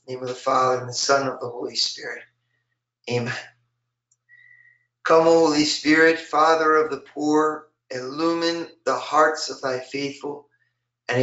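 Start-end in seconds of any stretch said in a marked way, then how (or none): none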